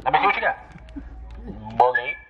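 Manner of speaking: normal talking voice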